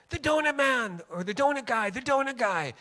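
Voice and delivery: high, loud call down the street; imitated excited scream. People announcing the donut man downtown, imitated scream. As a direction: holler it out as a mimicked street cry. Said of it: "they would scream".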